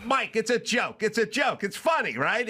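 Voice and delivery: high, pleading insistence; exasperated insisting. Retold or imitated. To Mike on the phone, high exasperated insisting. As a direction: push it high, begging him to get it. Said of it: "I go".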